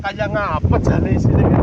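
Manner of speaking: slow, loud puzzled call. Real, not acted. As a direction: drag it out loudly over wind. Struggling, speaking over wind.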